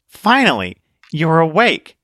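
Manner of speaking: a light hint of a cheerful girl sniper; purple-haired sniper girl. Girl greets newcomer from rifle.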